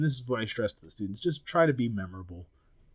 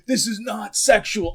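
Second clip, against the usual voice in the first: indignant, slow and higher; mock offended insistence; offended protest; 11 semitones higher, 7 dB louder, slower than usual. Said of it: "He's like".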